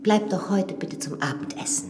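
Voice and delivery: soft whisper, no voice; whispered invitation. Acted. Widow asks the priest to stay for dinner, whispered.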